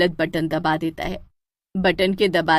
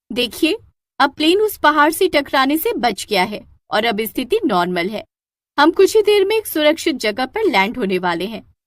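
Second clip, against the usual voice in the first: announcement; 4 semitones higher than usual. Acted. In-flight announcement.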